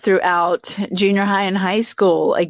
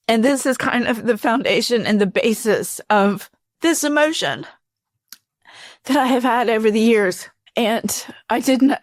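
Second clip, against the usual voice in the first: emotional; 4 semitones higher than usual. Real, not acted. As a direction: push on through the last of the tears. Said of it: "I'm getting kind of emotional".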